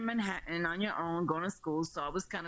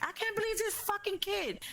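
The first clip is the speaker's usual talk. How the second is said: very high-pitched incredulous complaint; retold exasperated outrage. Mother finally seeing her missing daughter, retold high-pitched exasperation. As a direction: re-enact disbelief in a high, strained pitch.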